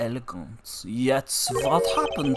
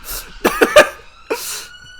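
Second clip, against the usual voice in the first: high laugh; 10 dB louder than usual. Acted. Character laughing.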